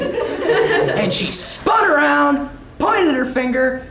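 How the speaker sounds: ordinary delivery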